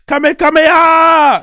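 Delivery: high, loud anime-style attack yell; mock battle shout. Child going back out to fight, mock battle shout. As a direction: shout it, building into a long cry.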